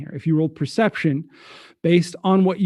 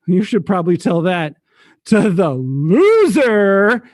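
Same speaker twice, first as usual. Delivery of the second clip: louder, stretched-out jeer on loser; gleeful taunt; 3 semitones higher, 5 dB louder, slower than usual. Acted. Francois points at Hannah, gleeful taunt. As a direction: draw out the insult with relish. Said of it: "wiping tears of laughter".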